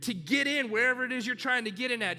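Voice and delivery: preaching at full force. Acted. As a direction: drive every word home from the pulpit.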